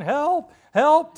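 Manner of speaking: high, loud cries for help; yelling for help. Acted. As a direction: shout it out in panic. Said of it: "he starts yelling".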